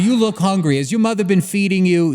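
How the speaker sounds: lightly hammed-up New Jersey mother; Jersey mom accent. Host mocking a Jersey woman in a mild Jersey accent. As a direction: play a pushy Jersey mom feeding a guest. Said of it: "on a woman talking about, like".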